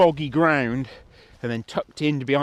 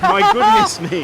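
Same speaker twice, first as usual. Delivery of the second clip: very high, loud and slow; high squeal; 9 dB louder than usual. Real, not acted. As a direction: squeal it out in cold shock. Echoing friend, squealing.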